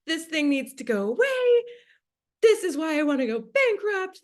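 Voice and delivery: high-pitched, mock-dramatic frustration; frustrated whine, played up for the story; exasperated outburst. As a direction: retell a fed-up rant, pitch pushed up. Retold outburst of frustration at entity.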